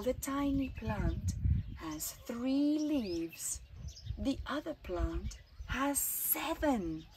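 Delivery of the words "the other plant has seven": usual speaking voice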